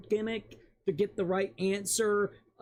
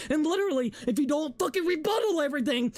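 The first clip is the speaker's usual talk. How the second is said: ordinary delivery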